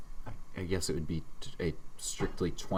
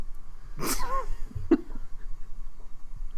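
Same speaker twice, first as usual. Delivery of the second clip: high unvoiced giggle; high laugh. Real, not acted. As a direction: giggle before starting the bit. Laughs at sarcasm topic.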